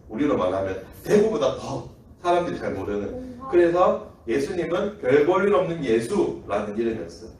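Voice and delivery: ordinary talk, nothing marked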